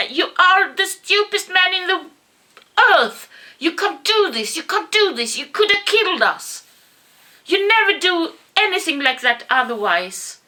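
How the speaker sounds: high, loud, furious shouting; angry yell. Retold or imitated. Yelling at the old man after the near crash, furious and loud. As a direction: scold him as if he nearly killed you. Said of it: "And I just yelled at him".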